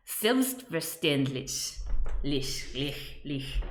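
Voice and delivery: German ch sound; German pronunciation. Shown as a demonstration. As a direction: savour the lich in selbstverständlich.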